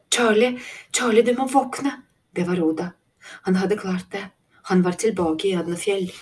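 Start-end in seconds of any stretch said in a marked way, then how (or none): 0.1-1.9 high, loud urgent calling. A narrator's reading. Girl waking a sleeping friend, urgent loud call. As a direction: shake him awake with your voice.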